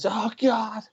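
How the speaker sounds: high-pitched imitated exclamation. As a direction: imitate an outburst that keeps building. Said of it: "he'll get louder and louder".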